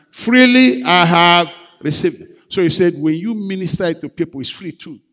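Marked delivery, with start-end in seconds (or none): 0.1-2.3 louder. Shown as a demonstration. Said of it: "let's say it one more time".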